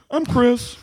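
tag. Retold or imitated. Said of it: "come on for three seconds and be like".

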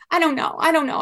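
mother reliving telling husband, mild panic; panicked. Retold or imitated.

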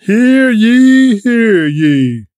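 loud formal herald cry; town crier. Acted. Herald opens the royal court.

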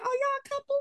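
squeaky high question; high curious voice. Retold or imitated. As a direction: ask it in a high, soft, nosy voice. People asking about couple.

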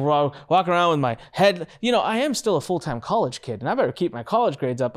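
ordinary talk

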